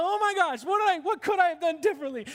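high, fast fretting voice; imitating a panicked forty-year-old; mock panicked self. Regretful person at forty, high and rushed. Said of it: "You're gonna go".

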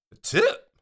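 sharp, high incredulous question; surprised. Retold or imitated. Guest asked for tip by porter, high incredulous squeak. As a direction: balk in disbelief.

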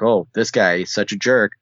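usual speaking voice

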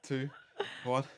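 ordinary talk, nothing marked

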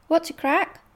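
ordinary delivery

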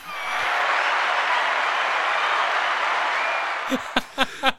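high airless giggle fit; giggling helplessly after a hiccup; high breathless laugh. Real, not acted. After hiccuping the name, a high helpless giggle.

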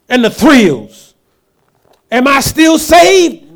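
building preaching. Acted. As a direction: rise in fervor, stretching the last questions.

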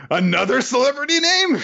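high-pitched incredulous question; mock shock. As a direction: exclaim it with pitch shooting up. Mock-shocked crowd after a gasp.

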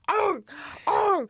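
put-on high toddler gobbling noises; imitated greedy gobbling. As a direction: mimic a toddler stuffing food in. Imitates toddler cramming fries by handfuls.

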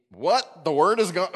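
mock disbelief. Mock baffled listener.

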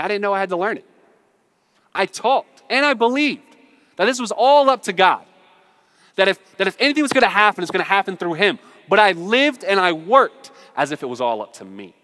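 high, loud, forceful confession; impassioned preaching. Real, not acted. Pastor confessing how he lived to congregation, impassioned.

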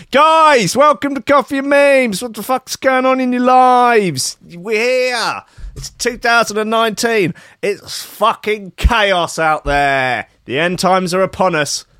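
loud, high-pitched shouted greeting; over-the-top announcer yell; hyped show welcome. Acted. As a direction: holler a welcome to the audience, stretching the words.

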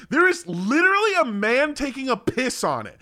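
high-pitched exasperated indignation; incredulous outrage. Real, not acted. Traveller spotting a man peeing on the arch, incredulous outrage. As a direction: pitch it up in disbelief, breaking off.

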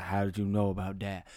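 low, hushed gruff man's voice; a lightly voiced wary tough guy; suspicious hero. Man questions fishing supplier.

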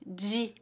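"di" softly as jee. Shown as a demonstration. Teacher showing learners D before I, D as J. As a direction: say "di" with an English J sound. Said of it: "a letra D antes da vogal I".

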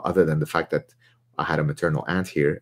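ordinary delivery

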